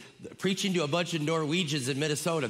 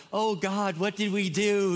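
comic dismay; 5 semitones higher than usual. Retold or imitated. Grandparents on day ten of babysitting, mock despair. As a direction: mock-desperate wail, pitched up.

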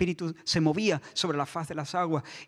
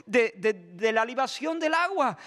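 usual speaking voice

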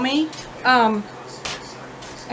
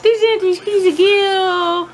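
high, slow, louder pet-talk; cooing at cat; 8 semitones higher, 5 dB louder, slower than usual. Real, not acted. Owner calling her cat Kisa, slow high pet talk. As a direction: stretch it out sweetly for the cat.

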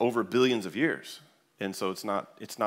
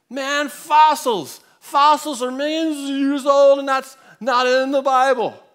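put-on high, slow, bellowing drunk voice; drunk guy shouting; 12 semitones higher, 11 dB louder than usual. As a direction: bark it out like a drunk who thinks he has won. Drunk man argues fossils.

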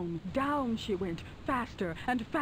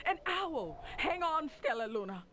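alarmed mother bat; 7 semitones higher than usual. Acted. Mother Bat warns baby.

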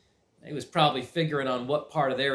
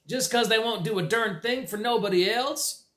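whining; 9 semitones higher than usual. Acted. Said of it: "Pete whined".

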